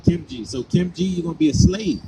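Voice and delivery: usual speaking voice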